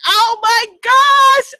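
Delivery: slow, high and loud oh my gosh; shocked gasp. Real, not acted. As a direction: stretch it out in real shock. Frog in the sink at 3am.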